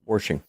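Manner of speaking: "washing" said as "warshing"; extra R. Shown as a demonstration. Confirming the habit to friends, "washing" said "warshing". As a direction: put an R before the SH in "washing". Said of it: "you put extra R's in your words".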